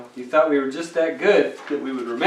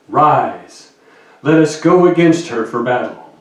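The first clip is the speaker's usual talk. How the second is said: louder announcer-style scripture reading; radio voice; 7 dB louder than usual. Acted. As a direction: read it like a radio broadcaster. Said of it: "in my radio voice".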